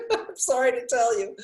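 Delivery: laughing apology. Real, not acted. Admitting she knows nothing about yawning, laughing. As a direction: let the laugh spill into the words.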